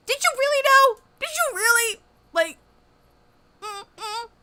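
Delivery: very high, loud incredulous squawk; doubting friend. Retold or imitated. Skeptical friend grilling about the crush, very high. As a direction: question her like you can't believe it.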